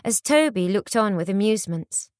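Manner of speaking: usual speaking voice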